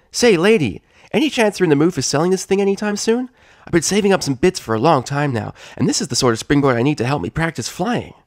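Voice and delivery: high, fast eager filly voice; a young pony pitching a deal, all excitement. Acted. Scootaloo begging the mare to sell, high and eager.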